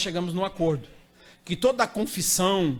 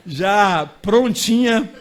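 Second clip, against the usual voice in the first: mock drawn-out punchline; 6 dB louder, much slower than usual. Joking about baptism in ice.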